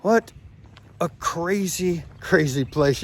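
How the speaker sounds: slow, loud amazed exclamation; drawn-out stunned wonder. Real, not acted. Awed reaction to the prison.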